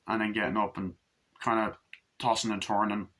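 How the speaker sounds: usual speaking voice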